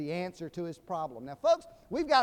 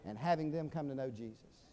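ordinary delivery

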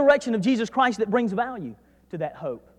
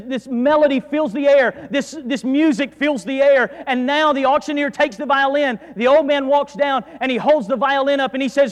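ordinary talk, nothing marked

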